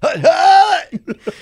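high squeaky hut call; quarterback calling cadence. Pretend. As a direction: yelp it like a snap count. Echoing the joke, high.

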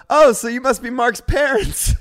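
slow, high, booming awkward question; loud blurt. Retold or imitated. Friend to the sister's boyfriend, loud blurt. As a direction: imitate a friend blurting it out loudly.

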